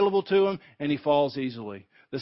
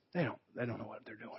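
much softer, fast, tender; tender plea; 6 semitones lower, 14 dB quieter, much faster than usual. Retold or imitated. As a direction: breathe it out as forgiveness. Jesus forgives from the cross.

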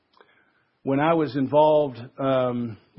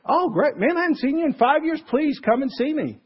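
much higher, fast, gushing delight; thrilled welcome; 10 semitones higher than usual. Retold or imitated. Old Young Life leader on the phone, overjoyed and gushing. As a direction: gush like an old friend overjoyed to hear from you.

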